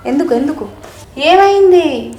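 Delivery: high, loud worried questions; startled, anxious asking; alarmed concern. Real, not acted. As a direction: rush in asking why she cries. Child suddenly starts crying.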